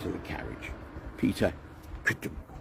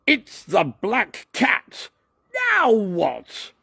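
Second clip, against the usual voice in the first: much higher, slow, staged alarm; rehearsed line; 14 semitones higher, 8 dB louder, much slower than usual. Shown as a demonstration. Douglas showing how his film line goes, high and slow. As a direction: act it out for the scene. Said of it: "Then I say".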